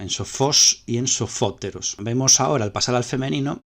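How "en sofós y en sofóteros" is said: slow, with FOS in "sofos" and FÓ in "sofóteros" punched; stress on same syllable. Shown as a demonstration. Teacher showing the accent stays put, stressing "fo" in "sofos" and "sofóteros". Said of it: "el acento se conserva en la misma sílaba".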